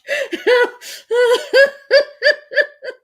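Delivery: long, high-pitched peals of laughter; helpless laughing fit. Real, not acted. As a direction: laugh on and on uncontrollably.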